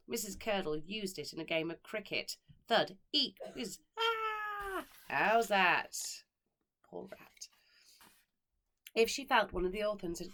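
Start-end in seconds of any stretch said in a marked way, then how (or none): 2.7-4.9 squeaky rat eek and whoosh; sound effects. A narrator's reading. Rat used as cricket ball. 5.1-6.2 gleeful cricket appeal. A narrator's reading. 6.8-7.5 soft unvoiced whisper; whispered aside. A narrator's reading. Narrator's hushed pity aside.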